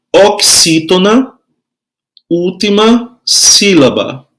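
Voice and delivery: slow, measured teacher's dictation; spaced-out phrases, drawn out for note-taking. Shown as a demonstration. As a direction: give them time to write each phrase. Dictating notes for pupils to write.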